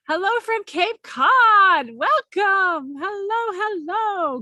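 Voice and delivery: high, bright sing-song hello; sing-song greeting. Real, not acted.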